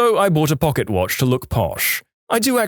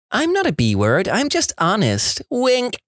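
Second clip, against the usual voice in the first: mocking voice; 9 semitones higher than usual. Mocking a cringy post.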